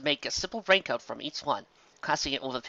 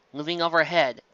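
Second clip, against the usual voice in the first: plain reading voice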